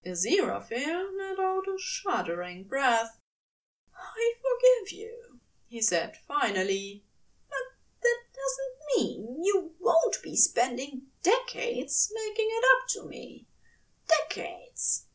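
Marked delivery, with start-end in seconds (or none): 3.9-5.4 angel forgiving. A narrator's reading. Aziraphale voiced high, forgiving. 7.5-15.1 higher angel voice with a teary, teasing edge. A narrator's reading. Aziraphale voiced high, teasing.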